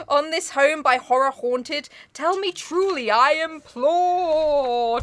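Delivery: slow recitation that stretches the final word; drawn-out poem reading. Acted. Reading The Raven aloud, slowly drawing out implore. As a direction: plead the line theatrically.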